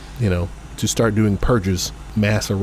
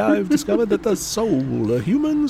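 Victorian showman; 9 semitones higher than usual. Retold or imitated. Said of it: "They showed up to the World's Fair, like".

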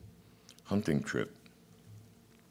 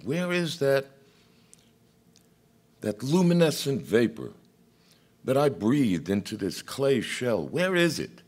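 much higher, louder cry of God; 11 semitones higher, 5 dB louder than usual. Acted. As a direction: cry out desperately searching. God searching for the soul.

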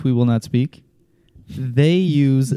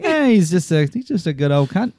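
put-on Australian accent; 3 semitones higher than usual. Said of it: "with an accent".

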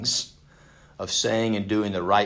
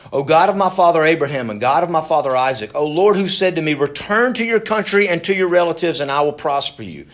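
loud, raised scripture prayer; declaimed prayer; 5 semitones higher, 7 dB louder than usual. Acted. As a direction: call out to God emphatically. Reads Jacob's prayer aloud.

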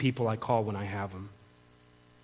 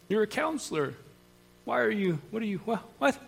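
high, soft stammering bewilderment; put-on puzzled voice, stumbling over the question; baffled disbelief; 7 semitones higher, 5 dB quieter than usual. Listener questioning a counselor in treatment, baffled disbelief.

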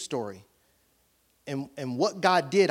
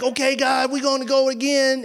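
eager retelling; 8 semitones higher, 5 dB louder than usual. Retold or imitated. Pastor retells eager talk with God.

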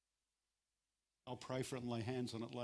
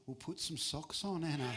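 put-on light Italian father accent, a bit higher; mock Italian dad telling her to put socks on; Italian father's voice scolding fondly; 4 semitones higher than usual. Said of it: "in an Italian accent".

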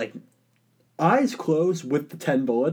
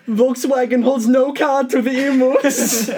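raised mock-German voice on the edge of laughter; German accent; 6 semitones higher than usual. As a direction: play an impressed German soldier. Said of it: "I'm doing a German accent".

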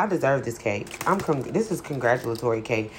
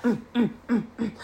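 high hummed mm sounds, mock restless brain; quick anxious humming; racing-brain hum; 8 semitones higher, 3 dB quieter than usual. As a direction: hum like your thoughts won't stop. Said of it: "my brain starts going like".

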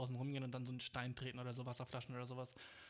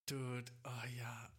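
whispering; 3 semitones lower, much slower than usual. Real, not acted. Sudden idea, whispered.

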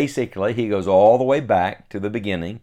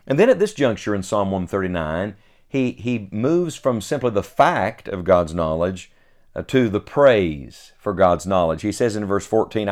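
ordinary talk, nothing marked

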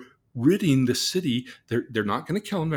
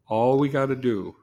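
usual speaking voice

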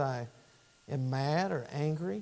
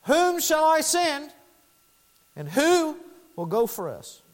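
hint of a divine call, opening high and drawn out; slow, raised call, easing down on the second question; preacher lightly voicing God's call; 9 semitones higher, 4 dB louder, slower than usual. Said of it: "the voice of God said this".

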